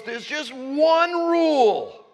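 high, loud, slow, drawn-out decree; voicing God. God telling Adam and Eve the single rule, high and slow. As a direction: lay down the one rule, stretched and emphatic. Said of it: "And God goes".

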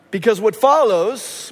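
ordinary talk

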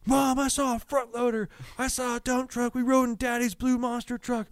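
put-on high, slow little-kid voice; breathless list of wonders like a small boy; excited toddler. Son tells mom about the day, high and thrilled.

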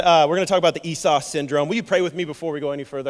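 usual speaking voice

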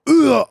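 loud, high retching ugh; sudden revolted retch; disgusted gag. Real, not acted. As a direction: gag on the sound. Gags imagining centipede in throat.

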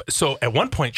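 ordinary talk

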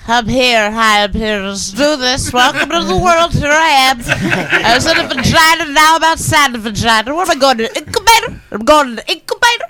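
put-on a baby proudly announcing its arrival; newborn baby voice. Imagining the drunk newborn talking, mock baby voice. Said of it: "I just pictured the baby being like".

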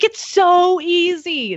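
lightly mimicked carefree friend; breezy friend voice. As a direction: stretch it out, so easy. Friend brushes off concern.

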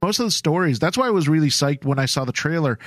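ordinary delivery

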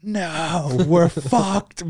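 high, loud desperate wail; family crying out at the runaway boat, high and desperate. Retold or imitated. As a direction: cry out like all is lost. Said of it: "like, a desperate".

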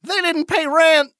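high, slow holler; shouting back. Acted. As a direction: holler back from inside the house. Yells back from inside infested house.

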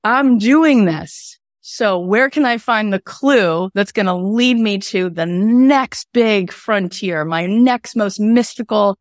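slow, bold voice of resolve; determined inner voice. Pretend. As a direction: savour each step like a quest.

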